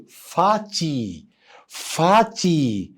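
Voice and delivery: فاتي drawn out slowly as fati; slow model pronunciation. Shown as a demonstration. Teacher giving the child the casual German word for daddy. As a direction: stretch فاتي gently. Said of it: "وممكن تقول إيه".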